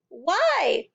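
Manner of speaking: desperate why; cried. A narrator's reading. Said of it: "Matilda cried".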